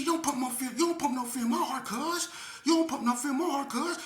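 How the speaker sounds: high, loud street taunt; tough woman's taunt. Retold or imitated. Skinny woman taunting the speaker in line, high and loud. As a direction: puff up and taunt him. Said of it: "She said".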